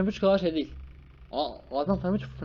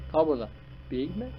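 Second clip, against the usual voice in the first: normal talking voice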